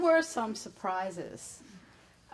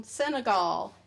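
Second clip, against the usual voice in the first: ordinary talk, nothing marked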